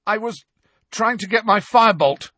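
high, strained excuse with a hesitation; croaked excuse. A narrator's reading. Boy held by the hair, croaking. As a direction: strain it out, halting after the start. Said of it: "Harry croaked".

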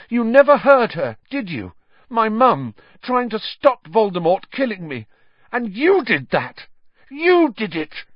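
high, loud, anguished accusation; shaking with rage. A narrator's reading. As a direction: build to a furious cry. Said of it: "his voice shook more than ever".

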